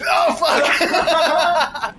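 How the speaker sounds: outraged laughing shout; raging gamer. Real, not acted. Dying in a sword fight.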